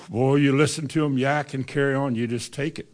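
normal talking voice

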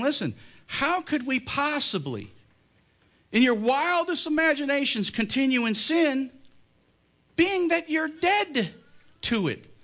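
impassioned sermon plea; preaching at full force. Acted. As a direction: plead with the congregation. Preacher voices Paul's plea.

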